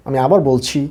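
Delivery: ordinary talk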